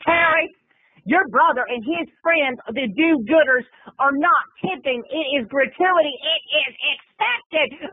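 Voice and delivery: high, sassy put-on dancer voice; mock dancer voice. Dancer scolds Terry about the tips, high and sassy.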